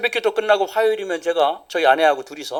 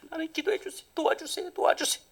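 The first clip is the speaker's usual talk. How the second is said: pleading prayer; 13 semitones higher, 12 dB quieter than usual. Retold or imitated. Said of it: "기도했대요".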